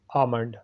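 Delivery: आमंड with a silent l, aa-mand; correct pronunciation. Shown as a demonstration. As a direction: say आमंड without the l. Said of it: "सही है".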